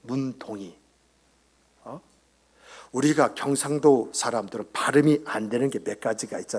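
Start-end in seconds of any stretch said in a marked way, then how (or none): none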